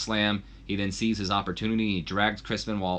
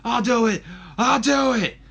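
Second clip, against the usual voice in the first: high, loud desperate cry of surrender; screaming in pain; 14 semitones higher, 7 dB louder than usual. Acted. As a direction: give in, yelling through the pain. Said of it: "he finally just screams out".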